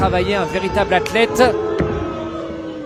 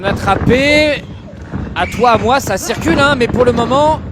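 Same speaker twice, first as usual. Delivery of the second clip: excited commentary; 7 semitones higher, 6 dB louder than usual. Real, not acted. Live action calls rising.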